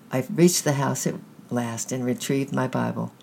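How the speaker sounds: usual speaking voice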